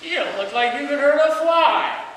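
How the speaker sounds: loud, high-pitched mocking jeer; hammed-up scornful taunt. Monk taunts the warrior.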